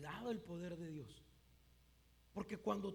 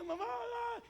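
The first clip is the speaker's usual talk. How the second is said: high, loud mock bawling; hammed-up childish cry; wailing child; 13 semitones higher, 5 dB louder, slower than usual. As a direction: wail for mum like a crying kid. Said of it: "¿Para qué lloras?".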